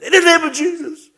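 high, loud mock command; sudden high-pitched yelled invocation; acted exorcism shout. Acts out casting out devils.

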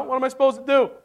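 ordinary delivery

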